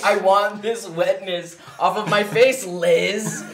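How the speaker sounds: slowed, half-laughing plea; laughing mock complaint. Complaining to therapist Liz, laughing. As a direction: whine it at Liz through laughs. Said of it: "I'm like".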